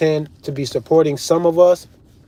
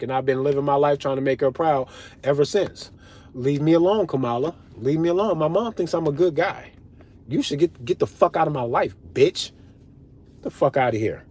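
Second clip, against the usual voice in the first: ordinary talk, nothing marked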